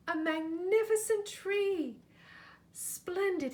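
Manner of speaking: high, loud delighted exclamation; excited cry. A narrator's reading. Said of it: "he cried".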